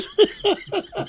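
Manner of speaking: a real laugh after the insult; high laugh. Real, not acted. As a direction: burst of high cackling laughter. Laughs at own rant.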